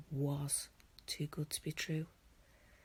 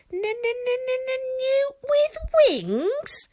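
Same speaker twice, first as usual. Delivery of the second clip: squeaky little dragon; 15 dB louder than usual. Acted. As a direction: squeal it like an insulted tiny dragon. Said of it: "repeated Toothless".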